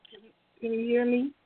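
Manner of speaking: tinny phone line sound; talking through a tin can. Real, not acted. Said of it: "You, you sound like you're talking through a tin can phone".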